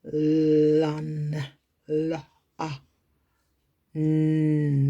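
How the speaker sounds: slow, separate phonics sounds with a long L and N; sounding out. Shown as a demonstration. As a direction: draw out each sound of Lun, la, ah, n on an even note. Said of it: "correctly sounding out each sound".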